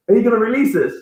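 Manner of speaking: put-on high Jamaican accent, lightly done; a hint of a Jamaican uncle. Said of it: "in a very thick Jamaican accent".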